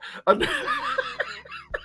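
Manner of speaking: helpless high laughter; high-pitched laughing fit. Real, not acted. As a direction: crack up mid-story. Cracks up telling dog prank.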